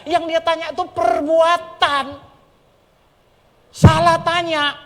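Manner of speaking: high, loud story preaching; full-force preaching. Acted. (khotbah berapi-api, tinggi dan keras)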